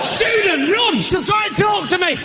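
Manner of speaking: high, loud cry of alarm; hammed-up panicked shout; mock panic. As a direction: yell a warning as if fleeing. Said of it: "Panicking 'cause there's just someone educated".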